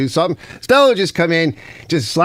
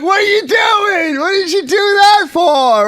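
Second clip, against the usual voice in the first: much higher, louder incredulous cry; exasperated yell; 4 dB louder than usual. Retold or imitated. Streamer yelling at Ken for cutting Simone off, much higher. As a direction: hurl it at him in disbelief.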